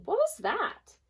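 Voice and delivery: who just hit me; startled hen. Acted. Startled hen looks around.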